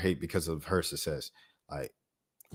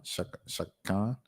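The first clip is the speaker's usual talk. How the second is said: normal talking voice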